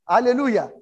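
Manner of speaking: loud, high cry of praise; shouted hallelujah. Real, not acted. Preacher crying out praise to his congregation, loud and high. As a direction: lift the whole room with it.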